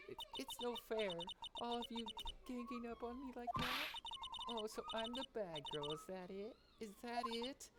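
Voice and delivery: high-pitched, wounded whimpering; mock tearful whine. Witness to the lawyers ganging up on her, high tearful whine. April May tearful, feeling ganged up on.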